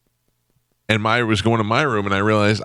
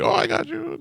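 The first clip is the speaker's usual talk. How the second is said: helpful guy; 4 dB quieter than usual. Retold or imitated. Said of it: "He's like".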